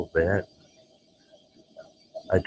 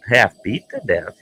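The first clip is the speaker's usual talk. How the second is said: ordinary talk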